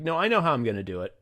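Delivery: ordinary talk, nothing marked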